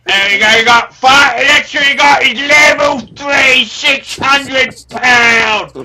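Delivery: loud ranting Cockney trader voice, swearing at the clients; shouting Cockney character. Acted. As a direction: bellow the price list in a Cockney accent. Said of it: "carried away there with the character".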